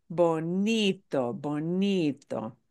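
bonito slowly with "ni" stressed; slow teacher's bonito, weight on "ni". Shown as a demonstration. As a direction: stretch the middle syllable of bonito. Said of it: "stress the second to last".

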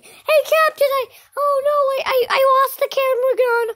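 high, stammering dismay; mock panic. Henchman telling Captain the camera gun is lost, panicky. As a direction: blurt it out in a high, pretend-worried voice.

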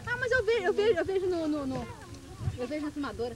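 excited insisting. Real, not acted. Insisting she can see it.

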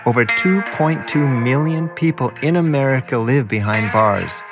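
normal talking voice

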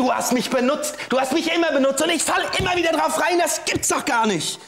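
bitter anger. Acted. Witness accusing the woman of using him, bitter and angry. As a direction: spit out the betrayal. Witness realises he was used.

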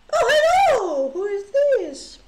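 high, drawn-out, loud hello; slow, high-pitched delighted surprise; surprised greeting. Real, not acted. Streamer greeting an unexpected visitor, surprised.

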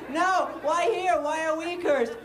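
high, drawn-out mock despair; exasperated lament. Comedian lamenting his town's sign, mock exasperation. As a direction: wail it lightly, comically aggrieved.

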